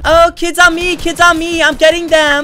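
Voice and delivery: man doing a woman's voice. Acted. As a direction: girlfriend voice in a fight. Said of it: "This is my voice".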